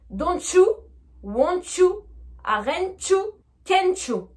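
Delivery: fuse each T and YOU into "chu"; linked with CH. Shown as a demonstration. Teacher modelling for repetition, YOU said as chu. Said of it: "répétez après moi".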